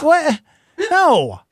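sharp high "What? No."; shocked refusal. Retold or imitated. Host balking at Steve-O's montage request, shocked. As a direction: recoil at the idea, pitch jumping up. Reacting to being asked for own montage.

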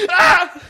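high squealing laugh; loud shrieking laugh while tickled. Real, not acted. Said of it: "it turned off my microphone".

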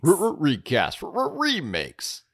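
stuttering radio-jingle echo, pitch jumping up; stuttered jingle. Pretend. Cohost announcing the recast segment, stuttering jingle-style. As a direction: stammer it out like a show segment sting.

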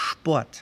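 "Sport" with initial sh, like "shport"; German "Sport" said "shpo-at"; S como sh. Shown as a demonstration. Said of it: "la S cambia su pronunciación". ("Sport" pronunciado "shport")

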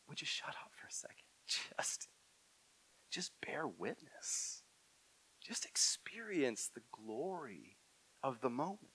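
soft unvoiced whisper, slowing down; whispered hush. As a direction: hush them gently, almost breathless. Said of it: "where God's like".

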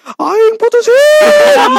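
high, slow, loud announcement; dramatic shout. Real, not acted. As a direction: belt out the punchline. Punchline of breakup story.

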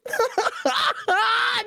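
high shrieking burst of laughter; laughing shout. Real, not acted. Bursts out after the prank call.

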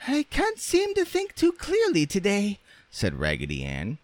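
very high, squeaky rag doll; Raggedy Ann's voice. A narrator's reading. Rag doll confessing muddled thoughts, squeaky. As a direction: speak in a tiny piping falsetto.

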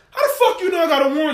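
fast, high, loud imitated outburst; indignant challenge. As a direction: fire back like a man wrongly accused. Acted father challenging the officer.

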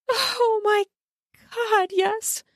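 very high, moaning delight; happy moan. A narrator's reading. Woman pleased by his touch, moaning. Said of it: "She moans her happiness".

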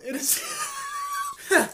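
put-on very high, slow baby-talk squeak. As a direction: coo it like talking to a baby. Teasing the shark.

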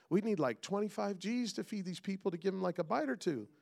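high, rising exasperated disbelief; panicked disciple. Retold or imitated. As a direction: sell it like a disciple who thinks Jesus is tripping. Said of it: "I love what one of the disciples says".

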